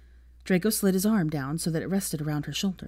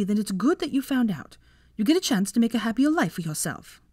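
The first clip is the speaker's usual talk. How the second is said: higher voice for a consoling friend; earnest, pitched-up encouragement; sympathetic female friend; 5 semitones higher, 3 dB louder than usual. Acted. Voices Daphne comforting Hermione.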